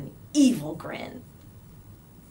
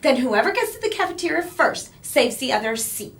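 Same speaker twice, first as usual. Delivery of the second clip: squealing; 5 semitones higher, 5 dB louder, faster than usual. A narrator's reading. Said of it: "Carla squealed".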